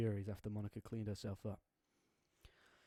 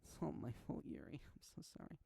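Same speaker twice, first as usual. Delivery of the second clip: broken whisper, fading; 4 semitones higher, 8 dB quieter than usual. Acted. Natsuki apologizing to Yuri, breaking into whisper. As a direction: voice sinking to a tearful whisper.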